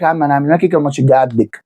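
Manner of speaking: low, hurried mimicked reply; imitated brush-off. Fan mimicking someone's excuse about the next match, low hurried brush-off. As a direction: toss it off low and fast, as a quote. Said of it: "اقول لك".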